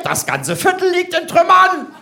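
high, loud shrieked "Trümmern"; scolding wife. Retold or imitated. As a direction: scold him at the top of her voice. (hohe, laute keifende Ehefrau)

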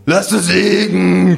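loud, slow bellowing; drunken bellow. Acted. Narrator telling the two men to leave the kangaroo, drunken bellow. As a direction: holler it across the bar. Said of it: "gröhl ich".

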